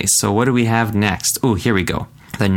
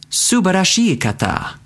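Subarashii kata said much higher; enthusiastic; 9 semitones higher than usual. Shown as a demonstration. Teacher acting out a great weekend, enthusiastic. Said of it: "demo answer".

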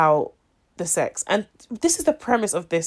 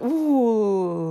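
swooning flirt; 3 semitones higher than usual. Pretend. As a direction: let out a big swooning ooh. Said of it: "you're like".

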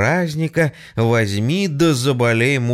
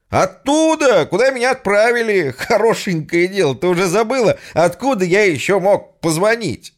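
much higher, loud indignant yell; loud exasperated shout; 10 semitones higher, 4 dB louder than usual. Acted. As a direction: shout down a bad line. Hero shouting over phone. (громко, с досадой в трубку)

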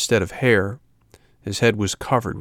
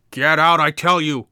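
higher, louder, barked order; threatening shout; 8 semitones higher, 6 dB louder than usual. A narrator's reading. Fisherman shouting at the hungry dog to leave, threatening. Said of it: "repeated the fisherman".

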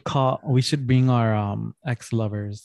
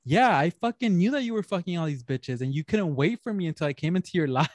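put-on accusing girlfriend; 5 semitones higher, 3 dB quieter than usual. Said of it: "She goes".

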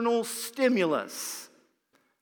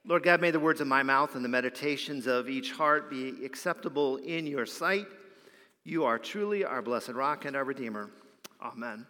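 low, softer prayer voice; lowered voice of a pastor in prayer; opening prayer; 6 semitones lower, 4 dB quieter than usual. Real, not acted. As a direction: pray it quietly and reverently. Opens the sermon with a prayer.

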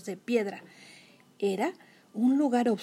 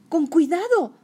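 tense plea; 6 semitones higher, 6 dB louder than usual. Acted. Frightened passenger in chase.

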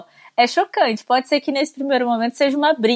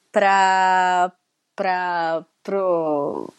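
forgetting mid-sentence what comes next; losing the thread; 3 semitones lower, much slower than usual. Real, not acted. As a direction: stall on each little word with long gaps. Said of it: "a Carol que esquece que ela tá falando".

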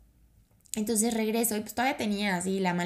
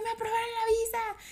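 much higher, emotional, near tears; tearful excitement; 14 semitones higher than usual. Retold or imitated. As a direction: blurt the news out while crying with joy. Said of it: "yo ya estaba llorando".

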